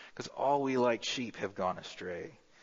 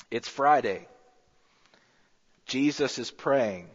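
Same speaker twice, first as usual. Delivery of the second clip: preaching S M Lockridge; 2 semitones higher than usual. Retold or imitated. Reciting Lockridge's sermon to the church, lightly preached. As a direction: hit each short line like a drumbeat. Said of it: "There's no way I can do the voice".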